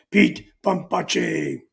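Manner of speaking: slow drawn-out DJ announcer name call. Shown as a demonstration. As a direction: roll out the name like a radio host. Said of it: "How's my D J voice?".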